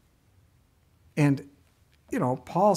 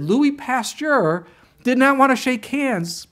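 incredulous; 10 semitones higher, 5 dB louder, slower than usual. Real, not acted. Marvelling at a strange fact.

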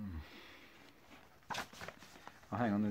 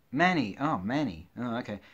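ordinary delivery

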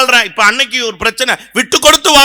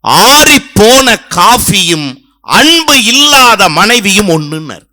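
slow quoted saying; 4 semitones lower, 3 dB louder, slower than usual. Retold or imitated. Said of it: "Variyar சாமிகள் சொன்னாருங்க".

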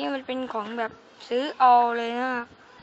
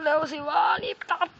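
impatient urging; 5 semitones higher, 3 dB louder than usual. Real, not acted. Urging himself to chop faster.

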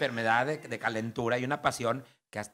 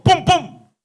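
loud, high vocal pum pum bangs; gunshot imitation; 14 dB louder than usual. Husband fires the pistol at the ceiling, shouted bangs. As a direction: spit out two loud gunshot noises.

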